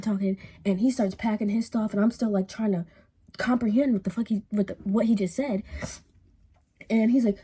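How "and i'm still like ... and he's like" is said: ordinary talk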